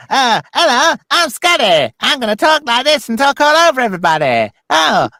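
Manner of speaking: high, loud cartoon Canadian voice; a squeaky South Park Canadian; Scotty as Canadian. Mocking Scotty in a high, loud South Park Canadian voice. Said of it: "how they draw Canadians on South Park".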